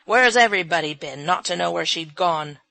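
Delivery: loud indignant exclamation; exclaiming. A narrator's reading. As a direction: demand answers from everyone. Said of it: "he exclaimed".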